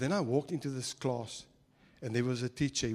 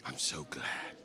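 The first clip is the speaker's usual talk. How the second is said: much lower, whispered "so glad"; 14 semitones lower, 8 dB quieter, slower than usual. Shown as a demonstration. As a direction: breathe "I'm so glad" as a secret. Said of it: "look at the person next to you and say".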